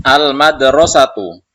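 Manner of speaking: teacher calls the word out loud, then falls; loud model reading. Shown as a demonstration. Teacher reading a word loudly for the class. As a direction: boom it out for the class to echo. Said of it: "Maka kita baca".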